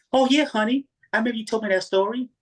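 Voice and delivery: high, sugary wife voice; mock seductive wife; sweet wife. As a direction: purr it like a too-sweet wife. Wife voice again.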